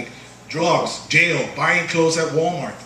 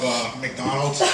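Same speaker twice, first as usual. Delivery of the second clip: ordinary talk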